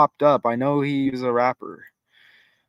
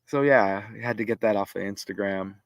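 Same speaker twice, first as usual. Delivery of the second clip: ordinary delivery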